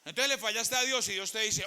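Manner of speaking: ordinary delivery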